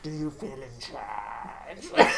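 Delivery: low, slow masked villain voice; Bane impression. Fan doing Bane's line low and slow. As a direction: draw it out low and menacing like Bane. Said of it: "That's what he does".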